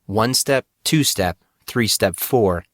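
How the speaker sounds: plain reading voice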